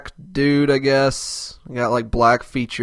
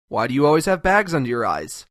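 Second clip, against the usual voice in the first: normal talking voice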